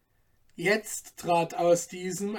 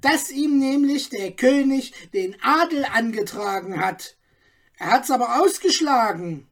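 knowing bystander; 6 semitones higher, 4 dB louder than usual. A narrator's reading. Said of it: "fiel ein Nahestehender ein".

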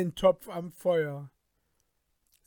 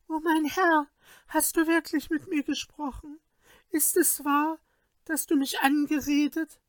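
high sorceress voice; 10 semitones higher than usual. A narrator's reading. Overjoyed sorceress answers the king.

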